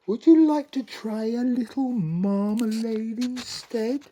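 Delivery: high, loud wheedling queen; queen tactfully coaxing the king. Acted. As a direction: coax him gently toward it. Queen offers marmalade.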